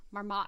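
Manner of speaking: "Marmot" ending in an open "maht"; hard ah imitation. Citing his odd pronunciation, "Marmot" ending in "ah". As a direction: say "Marmot" with a full second vowel, "ah". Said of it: "he has that kind of warder".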